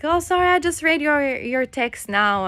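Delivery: high, loud apologetic husband; sheepish husband. Retold or imitated. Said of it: "he's like".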